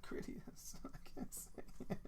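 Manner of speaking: unvoiced whisper, barely there; whispered aside. Real, not acted. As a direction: breathe it out to yourself.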